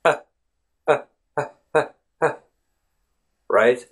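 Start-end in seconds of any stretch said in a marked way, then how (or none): 0.0-2.3 short, separate "uh" sounds, mouth barely open; tiny detached "uh" repeated for learners; isolated schwa. Shown as a demonstration. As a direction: demonstrate a bare schwa: "uh", pause, "uh". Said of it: "you just barely open your mouth".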